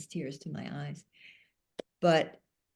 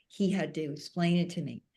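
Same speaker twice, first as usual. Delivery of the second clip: ordinary delivery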